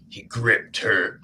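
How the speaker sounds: slow, low, hammed-up steamy read; mock romance narration. Host teasing a romance flash fiction episode, mock romance narration. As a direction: drag it out like a steamy paperback.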